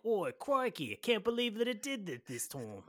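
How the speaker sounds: hammed-up British-Australian exclamation; cartoonish Cockney-meets-Australian accent; mock British accent. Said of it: "Dennis is like".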